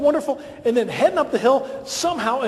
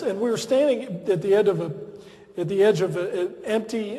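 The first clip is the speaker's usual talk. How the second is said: normal talking voice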